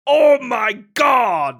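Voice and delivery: high, loud, slow mock Wario shout. Showing a friend the Japanese Wario line, shouted high. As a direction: belt it out like Wario failing. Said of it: "he goes".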